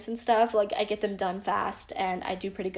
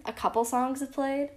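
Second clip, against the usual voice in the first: normal talking voice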